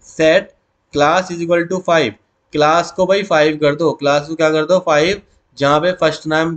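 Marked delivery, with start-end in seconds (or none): 0.1-2.1 very slow, word by word; slow dictation. Shown as a demonstration. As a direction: spell it out for students to copy. Teacher dictating SQL query.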